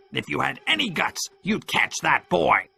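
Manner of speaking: Pew scolding. A narrator's reading. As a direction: goad the men, slowing at the end. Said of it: "Pew shouted".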